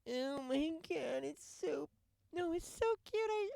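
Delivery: mock gushing. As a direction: ham up a fake dog lover's squeal. Mocking gushing fake dog lover.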